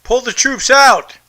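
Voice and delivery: ordinary talk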